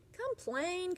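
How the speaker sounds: sharp, high-pitched grumble; grumbling. Acted. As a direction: snap out the first complaint. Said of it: "the man grumbled".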